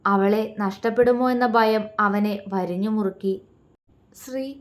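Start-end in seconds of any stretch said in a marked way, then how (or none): 4.1-4.6 soft, small call; pitiful plea. A narrator's reading. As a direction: call her name softly, pleading. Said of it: "ദയനീയമായി അവളെ നോക്കി".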